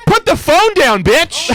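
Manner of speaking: mock exasperated shout. Comic scolding the selfie killer like a horror movie victim, high and exasperated.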